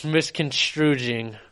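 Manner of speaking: slow misconstruing with a j sound, mis-con-TROO-jing; mispronounced misconstruing. Real, not acted. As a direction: stretch out misconstruing, garbled. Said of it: "Is that a word?".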